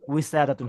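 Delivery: Arabic w sound starting উইসাদাতুন; Arabic waw. Shown as a demonstration. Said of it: "আর আরবিতে কিন্তু ওয়াও".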